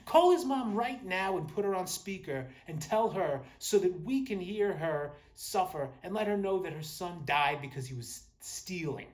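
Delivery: loud, high-pitched mock outrage; vengeful outburst. Neighbor demanding the mom be called, mock vengeful outrage. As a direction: act the vindictive neighbor, building loud.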